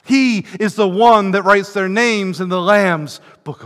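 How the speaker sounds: preacher exalting God's love before the church; climactic preaching. Acted. Preaching at full force.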